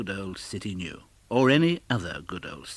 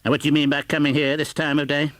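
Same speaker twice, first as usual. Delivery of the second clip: loud, fast, high fake-gruff demand; mock-stern scolding; 5 semitones higher, 8 dB louder, faster than usual. Scrooge pretending to scold Bob for arriving late, mock stern. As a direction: play the grumpy boss. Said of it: "growled Scrooge in his accustomed voice".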